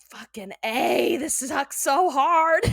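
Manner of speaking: loud, drawn-out groaning complaint; exasperated outburst. Retold or imitated. Stuck in quarantine until tested, loud exasperated venting. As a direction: build to a slow, high, loud whine. Acts out frustration over quarantine.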